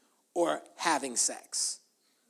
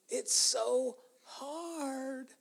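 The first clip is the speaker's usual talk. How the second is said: high, soft, drawn-out complaint; mock complaint; 5 semitones higher, 8 dB quieter, much slower than usual. Preacher mimicking Christians who find serving God hard, mock complaining. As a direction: stretch it out, mimicking someone put-upon.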